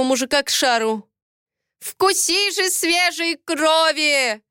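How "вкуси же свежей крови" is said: high, drawn-out fanatic cry; frenzied ritual cry without extra volume; crazed wail. A narrator's reading. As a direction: wail it slowly like a mad elder. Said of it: "раздался сумасшедший вопль старейшины".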